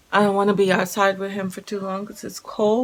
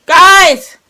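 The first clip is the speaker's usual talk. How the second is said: calling out; 9 semitones higher, 12 dB louder than usual. Real, not acted. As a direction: shout across the house to them. Calling out to kids.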